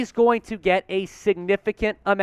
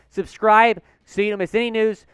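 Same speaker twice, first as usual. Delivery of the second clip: ordinary delivery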